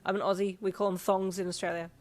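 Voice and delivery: ordinary delivery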